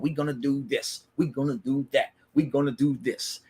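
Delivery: imitated cocky boasting, timbre put on; cocky and arrogant. Jimmy boasting backstage to his brothers, cocky and arrogant. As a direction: swagger through it like a boastful wrestler. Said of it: "cocky and arrogant".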